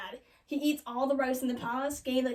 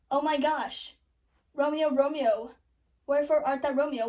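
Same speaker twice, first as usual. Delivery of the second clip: loud, hammed-up lament; loud, slowed mock-tragic calling; Juliet's mock dismay; 6 dB louder than usual. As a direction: cry out for Romeo in mock shock, near your own pitch. Juliet finds Romeo dead, acted.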